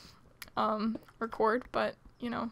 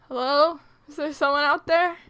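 calling out warily, louder; suspicious guard call; 3 semitones higher, 6 dB louder than usual. Real, not acted. Calling into dark cave.

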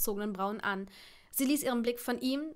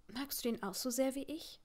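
soft, gentle murmur; quiet question; 6 dB quieter than usual. A narrator's reading. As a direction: ask it softly, as to a cat. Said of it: "fragte Hermine leise".

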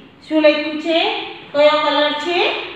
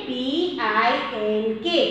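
soft, slow spelling of P I N કે; slow spelling recap; 2 semitones lower, 5 dB quieter, much slower than usual. Shown as a demonstration. Teacher sums up the spelling for children, soft and slow. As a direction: drop down and spell it out slowly. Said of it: "પિંકનો spelling".

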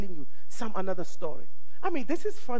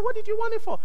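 high voice of wife; faster than usual. Retold or imitated. Voicing the shipper's wife.

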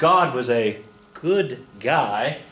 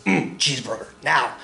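inner demon voice. Retold or imitated. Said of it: "your inner demon that says".

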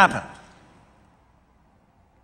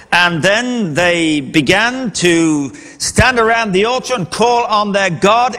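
ordinary delivery

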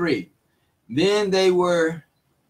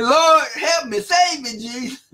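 high, crying plea for rescue; panicked plea; 8 semitones higher than usual. Acted. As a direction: beg to be saved, voice cracking high. Peter sinking, crying for help.

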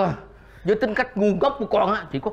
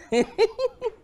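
high gloating cackle; 14 semitones higher than usual. Acted. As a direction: cackle in triumph over the board. Grandpa gloating over chess. (cười đắc thắng the thé)

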